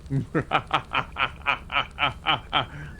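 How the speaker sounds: cartoon villain cackle; classic evil laugh. Shown as a demonstration. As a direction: cackle like a bad guy gloating. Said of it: "I can do your classic".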